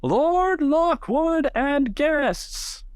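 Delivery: very high, slow, drawn-out herald call; herald's announcement. Acted. The herald at the ballroom door announces Lord Lockwood, in a high, slow herald call. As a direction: announce the lord's arrival to the whole hall. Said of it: "just shouts".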